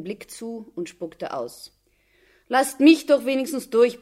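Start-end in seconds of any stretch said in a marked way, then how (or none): 2.5-4.0 raised, loud plea to be let through; pleading. A narrator's reading. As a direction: plead with the guards, louder and higher. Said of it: "bat die Mutter".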